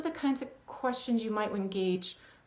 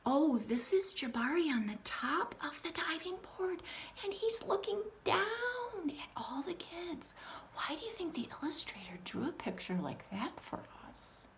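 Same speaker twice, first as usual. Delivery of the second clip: put-on whispered wonder for children; awed whispered read-aloud; hushed storyteller; 8 semitones higher, 5 dB quieter than usual. Shown as a demonstration. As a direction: draw the kids in with a whisper. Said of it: "I explained to the kids".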